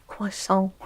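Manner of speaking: Croissant boldly mangled in a fake French accent; shitty French accent. Shown as a demonstration. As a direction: ham up a cheesy French croissant. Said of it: "with a shitty French accent".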